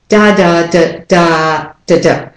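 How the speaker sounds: "da, da, da, da, da, da" in full and short beats; stress rhythm. Shown as a demonstration. Teacher giving the word's rhythm, strong and weak beats. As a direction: beat out "da, da, da, da, da, da", ending on two short ones. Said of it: "The rhythm is".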